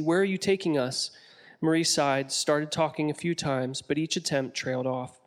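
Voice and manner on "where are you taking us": ordinary delivery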